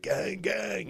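high, soft mock greeting from the driver; mimicked driver greeting. The driver in traffic switches to a friendly, high, soft gang greeting. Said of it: "he's like".

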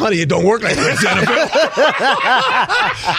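slow, laughing reply to wife; laughing, slow explanation. Retold or imitated. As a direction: explain it slowly, chuckling. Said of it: "I said".

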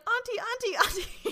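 put-on tiny, very high child voice calling; little toddler. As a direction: call for auntie like a toddler. Imitates toddler nephew on FaceTime.

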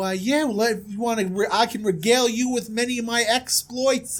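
hammed-up princely bragging; boastful prince. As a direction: burst in high, then swagger on. Prince boasts of father, exploits.

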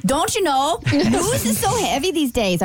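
put-on reading a listener's note in a light Midwest accent. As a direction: give it a folksy Midwest lilt. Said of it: "read this in her Midwest accent".

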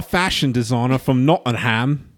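put-on posh British accent; a lightly put-on posh British accent. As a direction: speak it in a fake posh English accent. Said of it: "I don't know if that accent is accurate".

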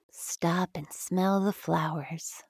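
ordinary delivery, nothing marked

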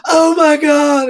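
high, slow, loud gush with a crying catch. Retold or imitated. Retelling the singer's reaction to the dog, high slow gush. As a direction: swoon over the puppy. Star gushing over the dog.

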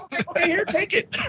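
giving up the watch to the robber, high and laughing; laughing nervous surrender. Retold or imitated. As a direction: hand it over giggling in mock panic. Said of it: "And I'm like".

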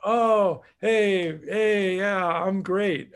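high, slow, mock-proud little kid; proud toddler. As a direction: play a toddler basking in applause.

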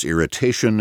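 plain reading voice

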